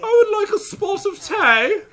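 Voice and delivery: high, hammed-up English accent, laughing through it; a deliberately awful posh English voice; bad English accent. Shown as a demonstration. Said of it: "my accent's so bad".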